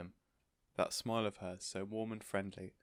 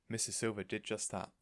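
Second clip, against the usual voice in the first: ordinary delivery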